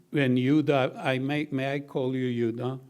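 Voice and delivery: usual speaking voice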